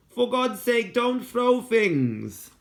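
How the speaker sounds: put-on high, slow girl's voice pleading; a child begging him to stop throwing; exasperated girl. A narrator's reading.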